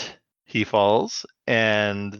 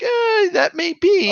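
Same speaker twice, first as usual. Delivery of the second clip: much higher, slow, nasal-free mock drawl; smug cartoon hero; 5 dB louder, slower than usual. Retelling the hero's reply to the villain, a high slow mock voice. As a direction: toss it off like a cocky cartoon rat.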